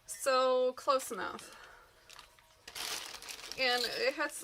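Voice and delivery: ordinary delivery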